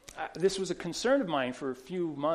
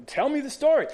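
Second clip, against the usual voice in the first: higher and louder, pressing him to go on; eager urging; 10 semitones higher, 7 dB louder, faster than usual. Retold or imitated. Pastor to excited prisoner: tell me the story, louder and higher. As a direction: push him to spill the story.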